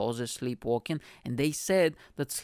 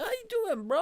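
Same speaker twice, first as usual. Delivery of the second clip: very high, breezy buddy greeting; friendly mates. Retold or imitated. Mates greeting Mike in dream jail, very high and cheery. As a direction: sing it out high like a cheery pal.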